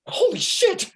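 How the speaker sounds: high, loud startled cry; shocked yell. Acted. Steve hears a scream offstage, shocked yell. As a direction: blurt it in shock.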